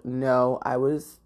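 ordinary talk

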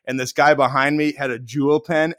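normal talking voice

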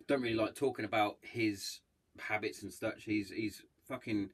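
normal talking voice

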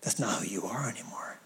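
low whisper; hushed reassurance. Real, not acted. Preacher assuring church that is not who they are, whispered. Tender reassurance after the verse.

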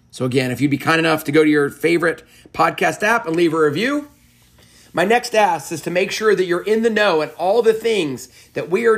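rally the audience like a closing promo read; upbeat podcast outro appeal. Acted.

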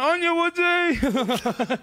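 high, long drawn-out holler; comic re-enacted cheer across a yard. Inmates cheer their mate being dragged away, hollering.

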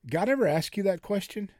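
normal talking voice

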